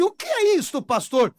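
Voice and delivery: high, startled objection to the pastor; voicing a doubter's shocked question; incredulous question. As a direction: squeak it out in disbelief. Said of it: "Aí você diz assim".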